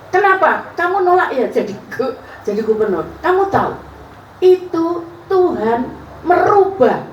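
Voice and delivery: high imitation of the pastor; pastor's voice. The pastor returns and questions her, high voice. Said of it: "datang lagi pastor ini".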